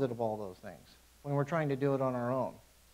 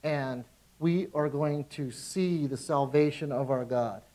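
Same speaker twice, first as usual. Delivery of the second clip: normal talking voice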